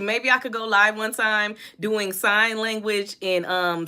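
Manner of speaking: ordinary delivery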